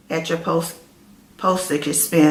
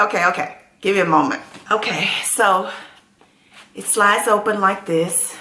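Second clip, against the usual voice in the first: ordinary talk, nothing marked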